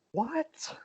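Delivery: very high, soft disbelieving what; stunned gasp of a question; shocked. Retold or imitated. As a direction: wrestler hearing he was fired, stunned. Shocked at being fired.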